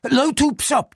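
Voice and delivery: high, hurried nervous voice; nervous name. Acted. Frightened clerk gives his name to the police, high and nervous. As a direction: blurt out your own name.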